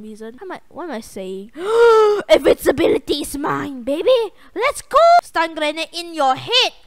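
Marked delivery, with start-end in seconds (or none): 1.6-2.2 sharp excited gasp; big thrilled gasp. Real, not acted. Sudden big game win. 2.3-6.7 loud triumphant gamer shouting; hyped yelling. Real, not acted. Gamer celebrating a win.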